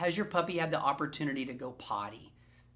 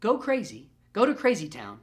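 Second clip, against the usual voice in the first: louder, excited mock cheer; a playful louder "go crazy" cheer; hyping the puppy up; 3 semitones higher, 7 dB louder than usual. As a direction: egg the pup on playfully. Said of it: "you're saying".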